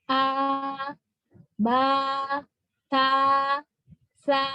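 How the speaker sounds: light, spread vowel held on each of "A, ba, ta, sa"; crisp thin vowels. Shown as a demonstration. Teacher showing istifa letters, crisp thin vowels. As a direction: pull lips sideways for "A, ba, ta, sa". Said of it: "suaranya agak renyah ya".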